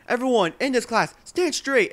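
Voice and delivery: loud, high-pitched command to a class; strict shouted order. Retold or imitated. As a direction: imitate a strict teacher yelling orders.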